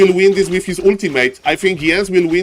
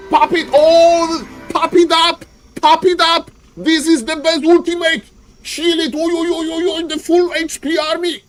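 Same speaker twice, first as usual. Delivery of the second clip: much higher, urgent, rapid hype calls; hyped commentary; 10 semitones higher than usual. Real, not acted. Caster urging Jens to pop the ultimate, hyped. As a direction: urge the player on like a fan.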